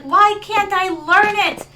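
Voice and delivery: imitating a friend's loud frustration; frustrated wail. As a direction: wail it slowly like an exasperated student. Retold friend frustrated at failing French.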